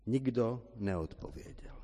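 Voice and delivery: ordinary talk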